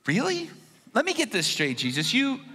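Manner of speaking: high, rising disbelief; can't believe what he's hearing; incredulous. Acted. Peter doubting Jesus's fishing tip, incredulous and high. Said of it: "Peter's like".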